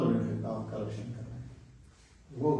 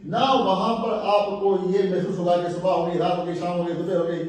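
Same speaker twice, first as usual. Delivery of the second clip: louder, higher sermon build; fervent sermon passage; preaching with rising fervor; 7 semitones higher, 10 dB louder than usual. Acted. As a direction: lift the crowd along.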